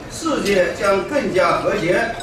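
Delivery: usual speaking voice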